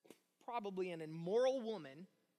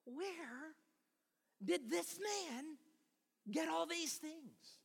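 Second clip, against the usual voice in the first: pointed disbelief; 7 semitones higher, much slower than usual. Acted. Townspeople's sceptical question, acted.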